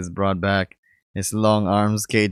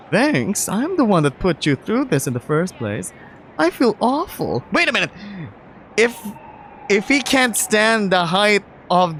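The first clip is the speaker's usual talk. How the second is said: put-on high, upbeat young man; bright excited tour guide boyfriend; lifted pitch, cheerful guy; Mark's voice; 11 semitones higher than usual. Mark voiced again.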